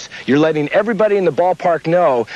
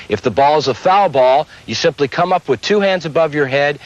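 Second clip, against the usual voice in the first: ordinary delivery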